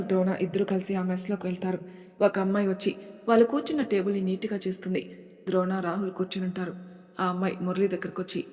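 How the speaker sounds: ordinary delivery, nothing marked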